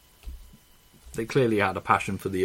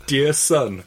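laughing; 6 semitones higher, 8 dB louder than usual. Retold or imitated. Reading mum's letter aloud.

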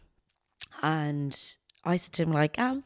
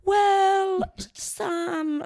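hesitant staffer. Retold or imitated.

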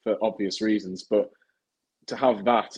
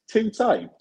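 surprised laugh; 12 semitones higher, 3 dB louder than usual. Real, not acted.

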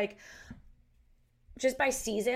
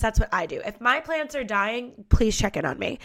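ordinary delivery

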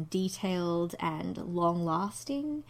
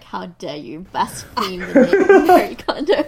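loud laughing mock outrage; playfully scandalised; 2 semitones higher, 15 dB louder than usual. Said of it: "She's like".

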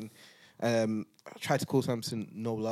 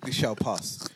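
usual speaking voice

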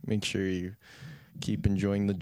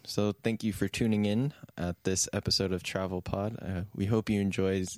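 ordinary talk, nothing marked

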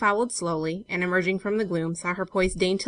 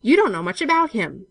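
raised, louder, sharp reply; sharp retort; 5 semitones higher, 7 dB louder than usual. A narrator's reading. Benson correcting the girl about Wilfred, sharply. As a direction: cut her off sharply. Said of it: "said the other sharply".

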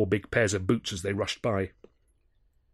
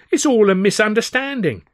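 placating; 12 semitones higher, 9 dB louder than usual. Acted. Malden placating police.

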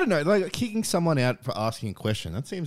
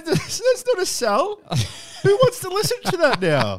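laughing; 5 dB louder, slower than usual. Real, not acted. Laughing at the terrible ad.

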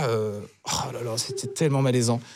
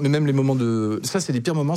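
ordinary talk, nothing marked

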